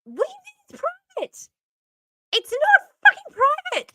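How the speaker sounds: high, loud, sarcastic outburst; mock outrage. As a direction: squeal it in outraged disbelief. Mocking ranting at politician.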